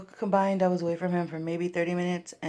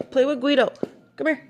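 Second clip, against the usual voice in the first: very high, loud coaxing voice for a dog; coaxing the puppy; 10 semitones higher, 5 dB louder than usual. Real, not acted. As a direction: egg the puppy on, high and excited. Urging puppy to play with toy.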